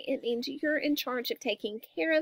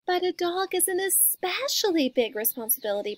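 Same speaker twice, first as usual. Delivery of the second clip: higher-pitched motherly objection; protesting mama; 6 semitones higher than usual. A narrator's reading. Mama objecting to getting a dog, protesting. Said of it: "protested Mama".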